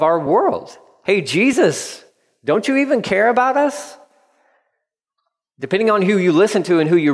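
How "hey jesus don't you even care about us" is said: pleading prayer. Retold or imitated. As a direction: cry out to Jesus, voice climbing high. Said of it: "a prayer that's being prayed".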